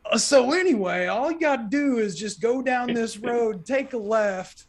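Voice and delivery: high, breezy directions as if nothing happened; old farmer carrying on. Retold or imitated. Farmer to salesman, carrying on high and unbothered. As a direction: brush it off and point the way.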